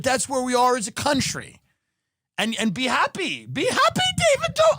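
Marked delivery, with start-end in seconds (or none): 3.5-4.8 mocking call. Mock calling out to Dobrik.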